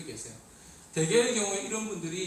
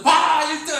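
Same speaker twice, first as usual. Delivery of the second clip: very high, much louder bragging yell; boastful shout; 9 semitones higher, 13 dB louder, slower than usual. Retold or imitated. Kid bragging to mom about first place, loud high shout. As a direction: shove the report card in her face.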